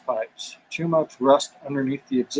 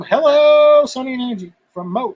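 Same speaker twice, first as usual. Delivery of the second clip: excited greeting; 8 semitones higher, 3 dB louder than usual. Acted. Greeting viewers with a high voice.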